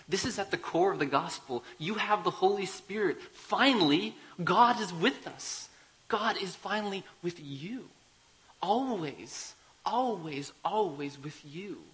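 loud, high, impassioned preaching; swelling to a loud, ringing peak; preaching at full force. Acted. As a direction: raise the roof on the promise. Preaching climax of the sermon.

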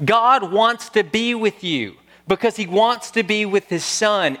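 slow, rising, emphatic assurance; fervent preaching. Acted. Preacher assuring Christians God is not angry, fervent slow preaching. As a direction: lean in and proclaim it slowly.

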